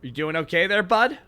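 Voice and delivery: high, loud rising Canadian "bud"; Canadian asking a stranger if he's okay; Canadian accent. Shown as a demonstration. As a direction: ask it like a Canadian sizing you up. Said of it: "What does the phrase".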